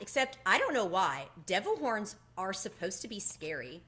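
plain reading voice

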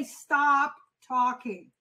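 drawn-out, firm "Stop talking." with weight on stop; slow firm stop talking. Shown as a demonstration. Sales coach leading the class, slow firm delivery. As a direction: say "Stop talking." slowly and firmly, leaning on stop. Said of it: "So I want everyone to say".

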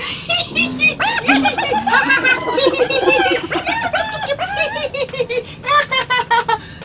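long high cackling laugh; high cackling hyena laugh; hyena laughter. Pretend. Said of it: "They laugh".

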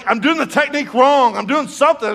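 high, loud comic complaint; retold frustration. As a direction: wail it in mock exasperation. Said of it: "I'm mad. I'm like".

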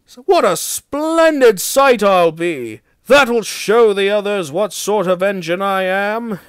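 high, pleased-with-himself crowing; gleeful boasting. A narrator's reading. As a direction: boast with delight, pitched well up. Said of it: "chortled Henry".

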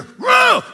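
sudden very high shout of rage; mock furious yell. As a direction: explode in a hammed-up fit of temper. Acted temper outburst.

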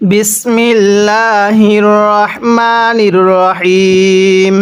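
very slow, drawn-out chanted Bismillah; Quranic recitation. Acted. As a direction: recite it slowly and solemnly. Opens lesson with Quran recitation. (ধীরে টেনে টেনে তিলাওয়াত)

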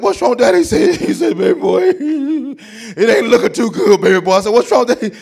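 loud, high, wailing mock cries; hammed-up sobbing. Son and dad in the ER, hammed-up wailing. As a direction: wail it like a melodramatic sob.

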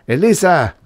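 high, loud shout; impatient call, pitch dropping at the end; rough summons. A narrator's reading. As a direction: bellow her name up the stairs. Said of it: "Thus roughly summoned".

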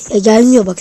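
loud frustrated shout; annoyed outburst. Real, not acted. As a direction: blurt it out irritated. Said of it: "sinirlendim ha".

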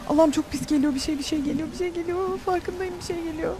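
sensing a monster coming; fearful dread. Real, not acted. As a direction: whisper your dread. Dread as something approaches.

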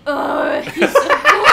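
laughing. Real, not acted. Laughing gushing over duck.

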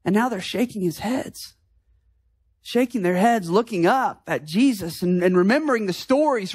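usual speaking voice

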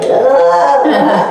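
hammed-up Wookiee cackle; Chewbacca laugh. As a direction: roar out a laughing Chewbacca noise. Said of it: "it starts going".

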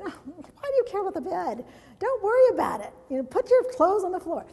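lightly hammed-up husband brushing it off; husband's voice. Husband voiced in teaching story.

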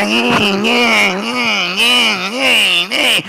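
high, repeated whiny "eh" noises; mock sissy squeals. Pastor mimicking a couple fighting like sissies, mock squealing. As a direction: imitate feeble flailing in a fight. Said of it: "They fight like sissies".

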